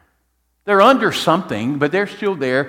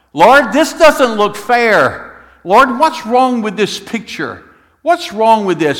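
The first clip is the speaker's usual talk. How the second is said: pleading lament; 7 semitones higher, 7 dB louder, slower than usual. Acted. Psalmist's honest complaint to God.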